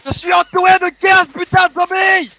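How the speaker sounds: high, loud cry for help; panicked gamer yelling out the danger; alarmed shout. Real, not acted. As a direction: yell it as if cornered. Surrounded by zombies in game.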